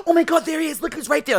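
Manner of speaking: high, loud excited call-out; mad loud. Retold or imitated. Friend loudly pointing him out across lunch table, mad loud. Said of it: "says that mad loud".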